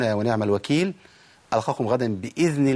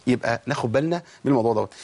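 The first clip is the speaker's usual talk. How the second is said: normal talking voice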